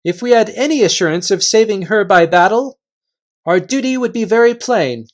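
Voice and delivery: Reepicheep, gallant. Acted. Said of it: "said Reepicheep".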